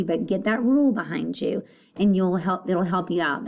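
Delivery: normal talking voice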